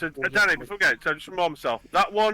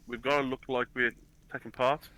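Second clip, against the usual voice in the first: lower, softened voice, not fully whispered; hushed aside; 4 semitones lower, 7 dB quieter than usual. Acted. Player murmurs to companion while handing a glass, hushed aside. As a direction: confide it quietly, keeping it secret. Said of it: "and I'll say, and I'll whisper".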